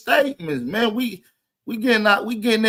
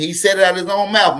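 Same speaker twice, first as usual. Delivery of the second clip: normal talking voice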